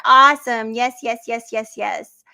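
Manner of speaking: a loud, happy burst of praise; excited. Real, not acted. Reacting to a viewer's comment with a loud, excited cheer.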